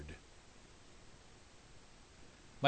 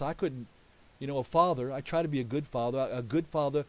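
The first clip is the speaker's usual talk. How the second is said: ordinary talk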